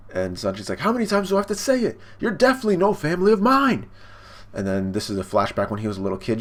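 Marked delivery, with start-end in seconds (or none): 0.8-3.8 high, hurried mock indignation; retold heated rejection; exasperated outburst. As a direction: act out a fed-up son, pitch way up. Sanji angrily rejects his father.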